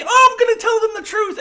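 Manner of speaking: very high, loud mocking quote; shrill-free sneer at her vow, shouted high; mocking Mariner. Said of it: "You don't say".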